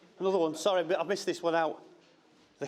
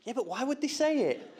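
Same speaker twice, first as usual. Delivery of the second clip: imitating daughter; 9 semitones higher than usual. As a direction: mimic her baffled innocence, pitched up. Daughter still not getting it.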